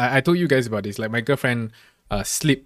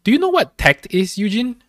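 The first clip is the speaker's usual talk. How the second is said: stern teacher; 9 semitones higher, 5 dB louder than usual. Retold or imitated. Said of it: "my teacher immediately looked at me".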